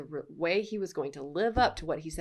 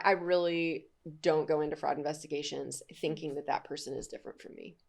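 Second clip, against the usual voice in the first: normal talking voice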